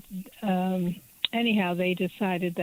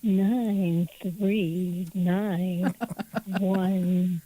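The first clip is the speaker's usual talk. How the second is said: slow, seductive phone-line voice; sexy voice; much slower than usual. Acted. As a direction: purr each digit slowly, like a hotline. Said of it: "say the phone number in a sexy voice".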